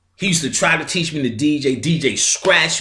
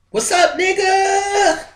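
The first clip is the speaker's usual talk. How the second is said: slow, much higher shouted hello; shouted greeting; 3 dB louder, slower than usual. Retold or imitated. Damon arriving at the rink, greeting him with a slow high shout. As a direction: call it out across the rink, stretched and high. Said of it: "was like".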